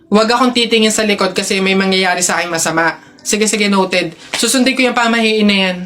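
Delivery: ordinary talk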